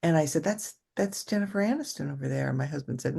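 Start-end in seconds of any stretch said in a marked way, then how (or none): 0.4-2.5 hushed aside. Retold or imitated. Whispering about celebrity in restaurant.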